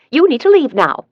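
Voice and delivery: a high, loud, firm order to get out; aunt orders the intruder out, loudly; stern order. A narrator's reading. Aunt confronts armed intruder.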